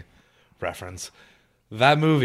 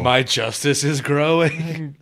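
hammed-up hero boast; 6 semitones higher, 8 dB louder, much slower than usual.

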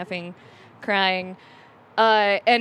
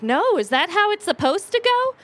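higher-pitched innocent question; naive questioning; 8 semitones higher than usual. Retold or imitated. Young woman asking the bar owner, naively puzzled. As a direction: act out wide-eyed innocence, voice climbing.

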